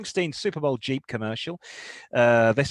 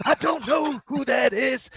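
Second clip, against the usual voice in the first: mock policeman; 10 semitones higher than usual. Imagined cop dialogue.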